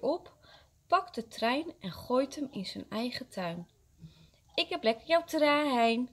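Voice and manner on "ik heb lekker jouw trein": put-on high, taunting child's crow; calling out. A narrator's reading. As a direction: gloat over a taken toy. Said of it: "roept hij".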